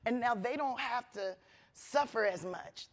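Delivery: ordinary delivery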